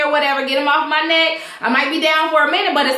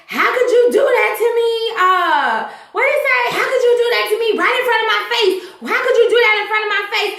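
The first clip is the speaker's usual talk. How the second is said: imitating Randall; 7 semitones higher, faster than usual. Randall accusing Marcy, retold.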